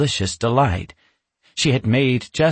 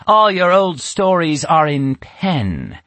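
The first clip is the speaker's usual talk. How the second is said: higher, slow, knowing witch; 6 semitones higher, 4 dB louder, slower than usual. A narrator's reading. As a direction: lay out the proof slowly. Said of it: "Natasha said".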